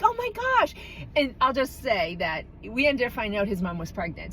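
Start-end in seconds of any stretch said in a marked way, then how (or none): 0.0-0.7 high shocked oh my gosh; squeaky mock shock; shocked gasp. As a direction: react in mock horror. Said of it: "I'm like".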